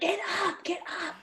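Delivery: high, soft, frantic hiss; urgent hushed urging. Acted. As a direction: urge a fallen friend under your breath. Kira tugging at fallen Paul's arm.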